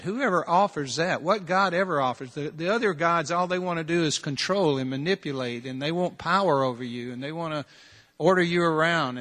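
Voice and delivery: usual speaking voice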